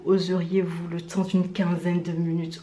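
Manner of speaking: spooky storyteller. Acted. Spooky hushed intro.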